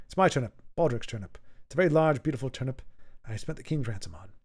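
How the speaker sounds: low, softened Mister Bean impression. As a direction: lightly mimic Mister Bean, trailing away quietly. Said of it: "I'm trying to picture Mister Bean saying that".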